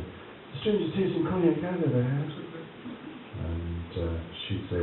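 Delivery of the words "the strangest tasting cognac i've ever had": put-on a lightly higher, faded grand old voice; puzzled old man tasting; mild imitation of Lawrence's baffled remark; old actor. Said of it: "He tasted the".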